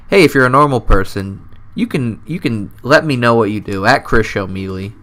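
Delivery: normal talking voice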